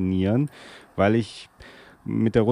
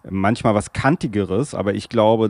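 usual speaking voice

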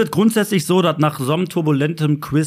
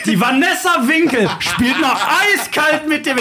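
laughing outrage; 8 semitones higher, slower than usual. Real, not acted. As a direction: yell in mock outrage, laughing. Outraged at being betrayed in quiz.

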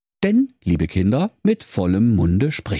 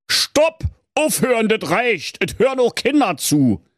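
wolf stops him sharply; interrupting wolf; 14 semitones higher than usual. A narrator's reading. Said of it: "fiel ihm der Wolf ins Wort".

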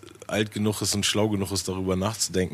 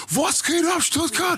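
high, energetic crowd call; hype MC shout; slower than usual. Retold or imitated. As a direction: fire up the crowd like a hype host. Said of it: "einfach dieser Energielevel". (Hype-Ansage an das Publikum, hoch und energiegeladen)

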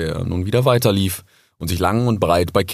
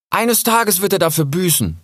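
high, resolute fox voice; fierce young resolve; determined; 11 semitones higher than usual. Acted. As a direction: vow revenge firmly. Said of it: "sagte Rix entschlossen".

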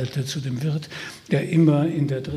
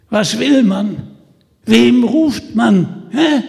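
loud, higher, hoarse call across; hoarse shout; 8 semitones higher, 9 dB louder, slower than usual. Acted. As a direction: bellow it across the street. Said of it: "mit derselben heiseren Stimme über die Straße herüberrief". (heiser über die Straße gerufen)

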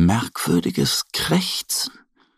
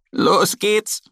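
higher squawked echo; parrot squawk; 10 semitones higher than usual. Acted. The parrot echoes Annie, squawking. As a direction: croak it like a parrot. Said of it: "krächzt auch Pirili".

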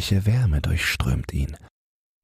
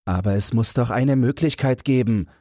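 urgent plea, much higher pitch; desperate protest; 8 semitones higher than usual. A narrator's reading. Traveller pleading with the stationmaster, urgent and high. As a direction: insist there is a way, pitched high.

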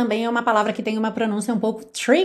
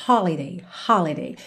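holiday stressed on HO, low and falling; stress on first syllable; 6 semitones lower than usual. Shown as a demonstration. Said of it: "sílaba tônica na primeira sílaba".

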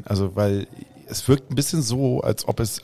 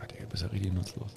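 much softer, an under-the-breath whisper; whispered insult; 3 semitones lower, 15 dB quieter than usual. Acted. Player whispering an insult at the useless cook. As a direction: mutter the insult so nobody hears. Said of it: "Flüster, sag ich nicht laut".

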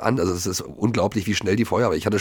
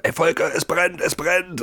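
imitating waking the director in a panic, pitched up; urgent fire alarm; 7 semitones higher, slower than usual. Waking director Volker next door at night, alarmed high call. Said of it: "habe gesagt".

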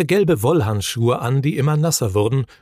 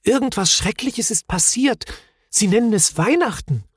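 high-pitched, alarmed little Moomin; startled and anxious, pitched well up; frightened; 10 semitones higher than usual. A narrator's reading. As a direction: report dreadful news in a high, scared voice. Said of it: "sagte Mumin erschrocken".

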